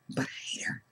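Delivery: whispered defiantly under the breath. Retold or imitated. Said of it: "I'd say it under my breath".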